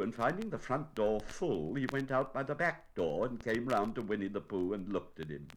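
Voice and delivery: ordinary delivery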